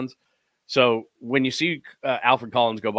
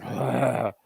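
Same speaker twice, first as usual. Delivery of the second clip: imitated breathy groan of exhaustion; exhausted groan. Host imitating tired player after third rep, exhausted groan. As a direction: groan like you're wiped out. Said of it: "He's tired".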